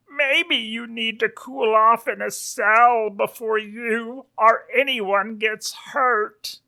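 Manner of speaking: high-voiced Western lawman; sheriff pig. Sheriff threatens accusing wolf with a cell, high cowboy voice. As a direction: raise the pitch and warn like a small-town sheriff.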